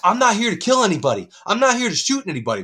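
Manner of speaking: loud, high-pitched imitation of a frantic man; high, insistent retold outburst; agitated mock shouting. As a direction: imitate him yelling his innocence at everyone. Acting out agitated man at security.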